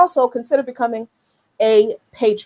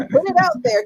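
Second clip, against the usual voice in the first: excited urging; 14 semitones higher than usual. Real, not acted. Urging viewers to fund the cause.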